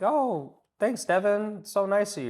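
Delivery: a hint of a gushing prospect; mock gushing prospect. Prospect voiced replying to compliment.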